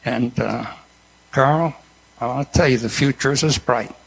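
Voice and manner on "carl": ordinary talk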